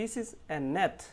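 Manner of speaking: each word slow and clear, rising on net; slow sentence demo. Shown as a demonstration. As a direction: read it out slowly for learners. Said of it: "সেটা হচ্ছে".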